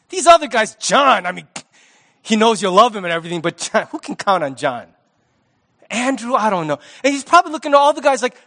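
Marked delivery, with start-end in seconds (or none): none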